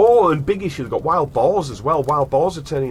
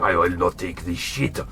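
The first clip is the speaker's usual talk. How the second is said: a hammed-up French farmer accent, lower; mock French accent; 6 semitones lower than usual. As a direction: growl it like a defiant French farmer. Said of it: "My accents are good".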